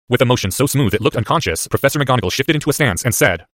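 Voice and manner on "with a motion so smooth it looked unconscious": ordinary delivery, nothing marked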